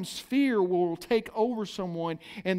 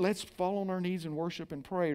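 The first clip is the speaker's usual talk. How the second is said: softer, lower pious tone; a lightly mocking pious voice, softer and lower; mock reverent; 4 semitones lower, 9 dB quieter than usual. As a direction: hush it like a pious prayer. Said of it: "not a very reverent".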